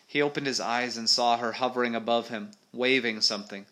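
animated adventure read-aloud; story narration. A narrator's reading. Reader telling of a monster fight, story narration.

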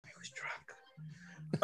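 confessing in a whisper, slowly; giggly prank whisper. Real, not acted. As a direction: whisper the secret slowly into the mic. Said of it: "I like how you whispered into the mic".

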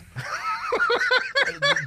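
loud, high-pitched laughing fit; high burst of laughter. Real, not acted.

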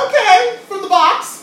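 high, loud incredulous squeal; loud high mock horror; shocked disbelief. Real, not acted. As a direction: sound scandalized by the box. Reacts to instant mashed potatoes.

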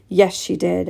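ordinary delivery

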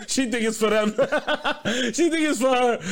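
high-pitched, laughing through the words; cracking up. Real, not acted. Riffing to his co-host about spaghetti for the week, cracking up. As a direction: barely get the joke out for laughing.

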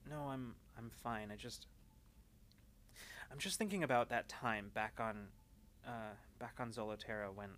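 soft, hesitant confession with long pauses; quietly troubled, feeling for the words; subdued, preoccupied Alma. Acted. Alma admits what she's been thinking about, hesitant and subdued.

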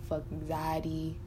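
ordinary talk

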